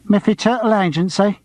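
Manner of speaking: put-on a Cockney girl picking up the agency line; Cockney receptionist. A narrator's reading. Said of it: "Her voice was Cockney".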